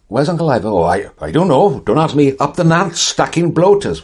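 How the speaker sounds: high, loud South Wales singsong; Welsh relatives. Retold or imitated. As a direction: sing it up like a Welsh aunt in the valleys. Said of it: "My mother's family were from South Wales".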